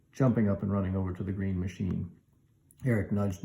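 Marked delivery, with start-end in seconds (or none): none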